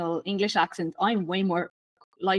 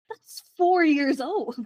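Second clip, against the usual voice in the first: high, drawn-out disbelief; incredulous cringe; 6 semitones higher, much slower than usual. Retold or imitated. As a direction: stretch it out, higher, aghast at how dated it is. Recounts cringing at outdated trend.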